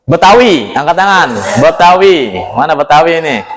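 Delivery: usual speaking voice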